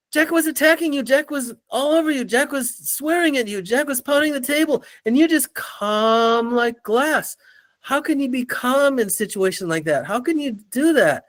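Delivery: high, breathless-with-amazement imitation of coworkers; astonished colleagues. Coworkers asking how he stayed calm under attack, mock incredulity.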